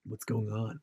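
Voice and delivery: put-on soft, laid-back American accent. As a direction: imitate a British actor doing American, quietly. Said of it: "do American accents when they go over there and they're like".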